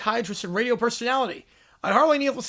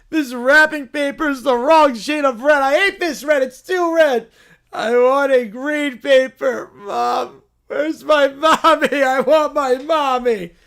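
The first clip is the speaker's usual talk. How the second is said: put-on whiny kid; 4 dB louder than usual. Spoiled kids opening Christmas presents, loud whining. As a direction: stretch every complaint, pouting. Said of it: "the Whiny Quintet".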